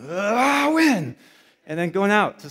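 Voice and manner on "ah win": mock huddle cheer. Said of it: "and going".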